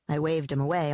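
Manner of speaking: ordinary delivery, nothing marked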